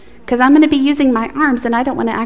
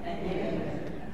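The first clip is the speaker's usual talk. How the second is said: whispered echo; 18 dB quieter than usual. Real, not acted. Children echo the prayer softly.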